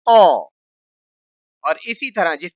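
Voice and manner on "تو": تو said thick and loud; تو with a thick, hard t; heavy emphatic to. Shown as a demonstration. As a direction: make تو heavy. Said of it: "اس کو موٹا کرتے ہیں".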